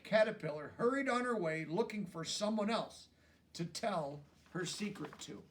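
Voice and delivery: plain reading voice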